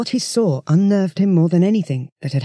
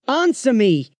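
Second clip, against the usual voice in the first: yelled; 7 semitones higher, slower than usual. Acted. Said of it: "he yelled".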